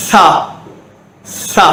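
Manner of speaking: சா as 'tha' with tongue between the teeth; சா begun with a long airy th. Shown as a demonstration. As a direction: only air through the th of சா. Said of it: "நாக்கு வெளியே வந்து காத்து மட்டும் வரணும்".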